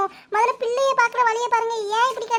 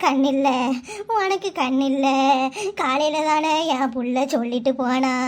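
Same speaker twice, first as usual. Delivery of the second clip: low, drawn-out lament with sobs; tearful wailing; 8 semitones lower, slower than usual. Acted. Grandmother lamenting over the girl who left that morning, wailing. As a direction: wail it low and slow, dragging out word endings. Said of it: "ஏம்மா, அழாத".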